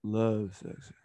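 low, put-on deep title voice. Host repeating the love section title, deep voice. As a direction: say the segment name way down low. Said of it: "Y'all put it with the deep voice".

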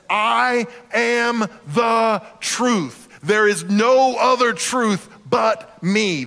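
very slow, stretched I am the truth; emphatic. Acted. Preacher proclaiming Jesus to the congregation, emphatic and slow. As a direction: land every word slowly like a verdict.

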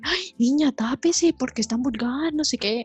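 scolding prude. Retold or imitated. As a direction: play a shocked old lady scolding. Strangers scolding a skater.

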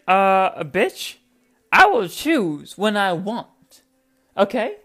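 heated retort. Pretend. Answering imagined pestering fans, loud and heated. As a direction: put the nags in their place. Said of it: "And I'm just like".

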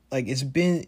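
normal talking voice

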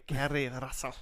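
mock Russian accent on the name; name said in an imitated Russian accent; Carrie Russell. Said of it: "doing a Russian accent".

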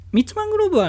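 密マングローブ thrown up very high and loud; jokey high exclamation. Pretend. Repeating his own pun on the three Cs, very high and loud. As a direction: punch out the silly word 密マングローブ way up top. Repeating the joke word emphatically.

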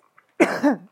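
loud hacking cough; harsh cough bursting out; coughing fit. Real, not acted. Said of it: "I gotta cough".

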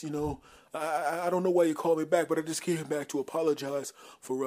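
high, hesitant, embarrassed mumble; nervously grovel for forgiveness; sheepish apologetic friend. Acted.

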